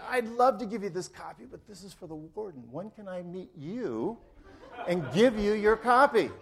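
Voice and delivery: high, loud, gleeful retelling of an eager answer; eager reply. Retold or imitated. Preacher retelling his delighted answer to the judge, eager and excited.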